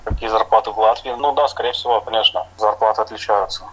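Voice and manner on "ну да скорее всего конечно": ordinary delivery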